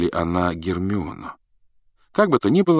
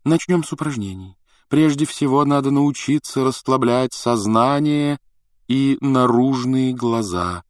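slow, mystical singsong on a raised pitch; 6 semitones higher, slower than usual. A narrator's reading. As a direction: intone it slowly, stretching the vowels. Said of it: "говорила она нараспев". (нараспев, протяжно)

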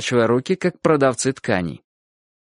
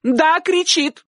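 very high, slow emphatic cry; shouted confirmation; much slower than usual. A narrator's reading. Aunt confirming to uncle, shouting. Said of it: "крикнула тётушка".

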